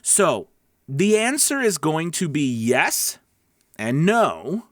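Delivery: ordinary talk, nothing marked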